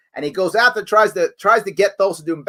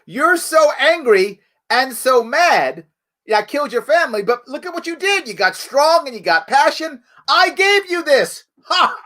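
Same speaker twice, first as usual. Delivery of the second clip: mock villain taunt; 8 semitones higher than usual. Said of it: "He actually says".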